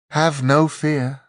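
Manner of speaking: lordly angel. A narrator's reading. Angel to its captives before taking creation, lordly. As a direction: proclaim it slowly as a grand angel.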